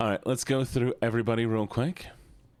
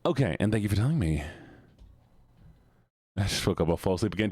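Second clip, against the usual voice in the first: ordinary delivery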